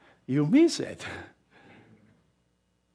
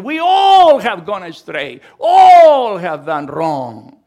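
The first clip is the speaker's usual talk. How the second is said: preaching shout; 3 semitones higher, 10 dB louder, slower than usual. Real, not acted. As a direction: thunder it out to the congregation. Preacher's climax on sin.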